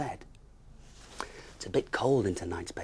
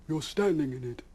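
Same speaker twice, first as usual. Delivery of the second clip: high, louder sad owl; sadly; 8 semitones higher, 7 dB louder than usual. Acted. Owl showing bear his ruined home, sad. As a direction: say it glumly, a hint of sorrow. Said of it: "said Mister Hoot-to-Wit sadly".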